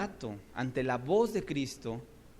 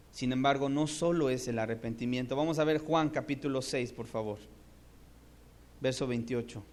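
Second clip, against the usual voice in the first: normal talking voice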